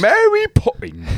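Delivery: high slow Mary Poppins lilt; high sing-song. Pretend. Sings the title high.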